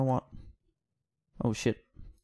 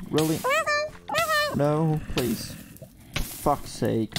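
usual speaking voice

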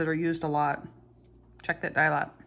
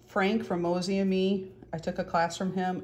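usual speaking voice

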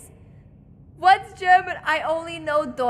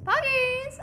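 excited cheer; 9 semitones higher than usual. Real, not acted. Cheers on picking up a key.

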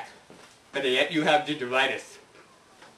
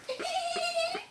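high giggle; 7 dB quieter than usual. Acted. Character laugh.

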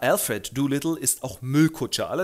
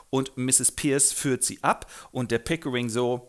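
ordinary talk, nothing marked

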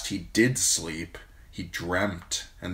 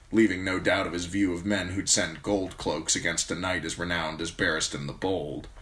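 ordinary delivery, nothing marked

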